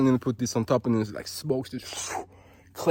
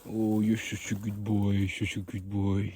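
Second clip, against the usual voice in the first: dog praise coo; 2 semitones lower, 4 dB quieter, slower than usual. Real, not acted. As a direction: soothe the dog slowly.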